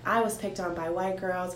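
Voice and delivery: ordinary delivery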